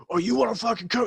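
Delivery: high-pitched mock belligerence; retold angry challenge. Retelling the first sergeant squaring up to a Marine, angry challenge. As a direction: imitate someone daring a fight, pitch jumping up.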